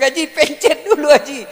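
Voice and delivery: high, loud retold correction; exasperated calling out. Teacher telling Haji to dial first, loud retold exasperation. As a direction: shout it to get through to the old man. Retelling himself correcting the caller.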